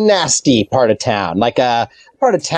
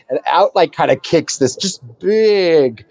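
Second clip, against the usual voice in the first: normal talking voice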